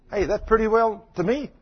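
ordinary talk, nothing marked

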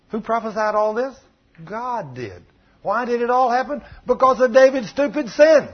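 high, forceful question and answer; impassioned preaching. Real, not acted. Preacher tells the congregation whose sin caused it, impassioned. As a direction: drive the verdict home loudly at the end.

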